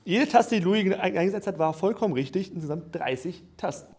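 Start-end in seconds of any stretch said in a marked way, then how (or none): none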